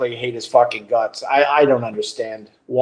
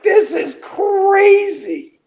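high, slow, drawn-out amazement; a retold, stunned aside; stunned disbelief; much slower than usual. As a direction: let the shock stretch each word. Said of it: "And I'm like".

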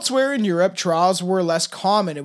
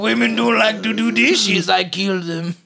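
mock French accent, a bit louder; put-on French accent; 3 semitones higher, 3 dB louder than usual. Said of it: "How do I do a French accent?".

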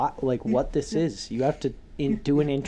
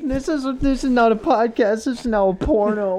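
mock outrage; 7 semitones higher, 4 dB louder than usual. Real, not acted. Mock outrage at pantsless friends.

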